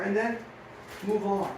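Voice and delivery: ordinary talk, nothing marked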